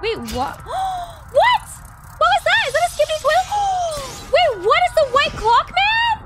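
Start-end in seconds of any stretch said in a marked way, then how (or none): 0.6-6.2 shocked disbelief. Real, not acted. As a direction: blurt it out in astonished disbelief. Shocked reaction to a sudden betrayal.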